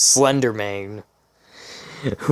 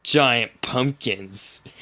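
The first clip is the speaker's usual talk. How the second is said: usual speaking voice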